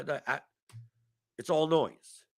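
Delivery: ordinary talk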